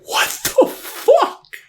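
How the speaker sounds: very high, slow squeal of disbelief through a laugh; laughing shock. Retold or imitated. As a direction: screech it out, cracking up in disbelief. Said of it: "I'm like".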